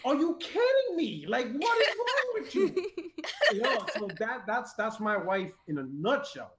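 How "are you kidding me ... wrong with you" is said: laughing disbelief. Retold or imitated. Retold disbelief at wife.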